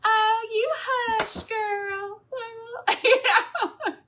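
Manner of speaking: hammed-up Southern lady, high and drawn out; slow, high-pitched mock Southern scolding; Southern accent. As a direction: put on a big playful Southern accent. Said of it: "started talking like that".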